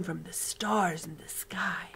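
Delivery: slow, awed whisper; hushed wonder. Acted. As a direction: whisper it like a secret. Giant tells of star music.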